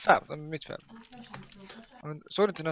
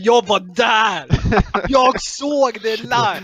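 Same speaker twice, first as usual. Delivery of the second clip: louder, higher, drawn-out excited claim; acted boast; 4 semitones higher, 6 dB louder, much slower than usual. Man insisting he saw the match live, hammed-up loud boast. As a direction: belt it out as an over-the-top eyewitness brag. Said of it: "Alltså, lyssna på den här".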